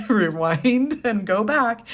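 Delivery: laughing through it, slow and high. Real, not acted. Reader redoing her reading while laughing. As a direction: giggle the words out.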